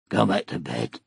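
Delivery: soft, low and gentle; a soothing murmur to a sleepy child; gently. A narrator's reading. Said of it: "he said gently".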